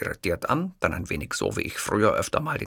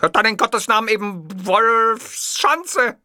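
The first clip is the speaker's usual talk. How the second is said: higher, slow, weary dictator voice; exasperated Hitler; 13 semitones higher, 6 dB louder, slower than usual. Acted. Hitler giving in to the clerk, exasperated. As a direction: give in with a sigh of disdain.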